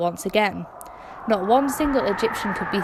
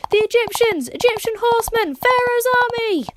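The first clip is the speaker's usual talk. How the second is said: panicked shout; 11 semitones higher than usual. A narrator's reading. Israelites spotting the chariots on the horizon, high panicked shout. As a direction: raise the alarm in panic. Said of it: "The shout went around".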